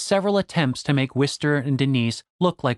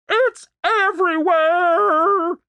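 drawn-out high wail; slow, very high anguished cry; cracking, near tears; 4 dB louder, much slower than usual. Acted. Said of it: "voice cracked like she was about to cry".

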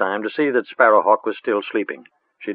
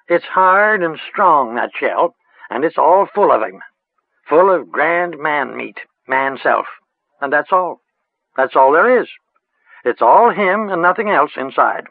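lightly voiced rustic old witch; a hint of a cackly village crone, musing; 6 semitones higher, 3 dB louder than usual. A narrator's reading.

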